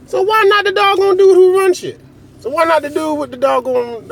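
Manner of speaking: high-pitched mock protest; high, insistent rhetorical question; exclaiming. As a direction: pitch it way up and hold it there.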